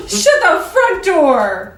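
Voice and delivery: slow, loud, laughing exclamation; mock outrage. Real, not acted. Friend reacting to the sergeant story, laughing outrage. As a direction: drag it out in laughing disbelief.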